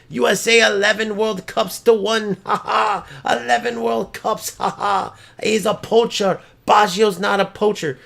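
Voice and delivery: high-pitched taunting voice with fake ha-has; mock gloating rival. Streamer acting out rival bragging about Division Three, high gloating mockery. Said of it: "you know what he'd be doing?".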